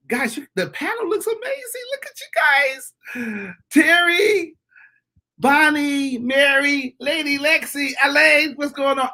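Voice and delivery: high, drawn-out excited shout-outs; gushing greeting. Real, not acted. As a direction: call out each friend with rising delight. Effusive greeting of the panel.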